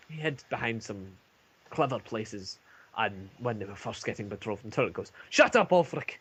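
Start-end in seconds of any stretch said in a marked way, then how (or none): 5.3-6.2 higher, louder angry shout; angry dwarf retort. Acted. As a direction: bark him into silence. Dwarf Turek snaps at Ulfric.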